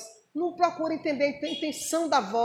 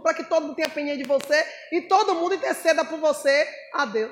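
ordinary delivery

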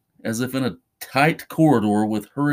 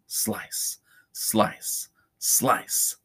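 near-whispered, each word set apart; hushed slicing; 7 dB quieter, much slower than usual. A narrator's reading. Narrator voices each cut on Charlie's wrist, hushed. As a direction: drop to a soft, breath-led hush.

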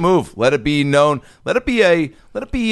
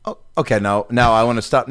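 usual speaking voice

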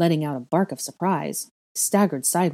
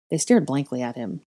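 ordinary delivery, nothing marked

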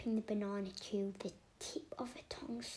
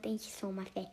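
plain reading voice

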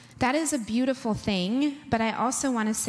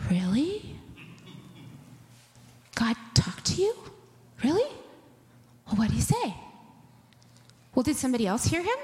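skeptical disbelief; 2 semitones higher, faster than usual. Retold or imitated. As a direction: sound like a newcomer who can't believe it. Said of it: "you're like".

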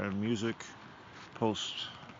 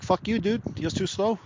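higher-pitched imagined brush-off; mock annoyed golfer; 7 semitones higher than usual. Golfer leaving his friend behind, mock annoyance. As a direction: play an impatient golfer, hammed up.

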